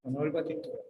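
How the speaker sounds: mock-embarrassed murmur of someone giving up dyeing their hair; timid refusal. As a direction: shrink back as if ashamed to dye your hair again. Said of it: "Y hay otros que dicen".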